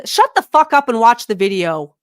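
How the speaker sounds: sudden loud shout at the screen; loud snap. Real, not acted. Yelling at a woman's video on screen, loud and fed up. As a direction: bark it out, fed up.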